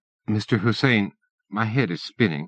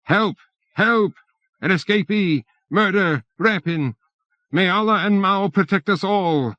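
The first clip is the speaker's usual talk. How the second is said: panicky shout; 9 semitones higher than usual. A narrator's reading. Bureaucrat shrieking at the stranger, panicky shout. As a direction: yell for help, backing away in terror. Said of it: "breaking into a panicky shout".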